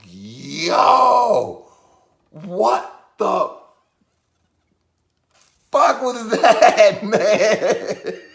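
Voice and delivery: high, loud shout breaking into laughter; drawn-out, laughing disbelief; incredulous outburst. Retold or imitated. To his friend by the elevator, laughing incredulous outburst.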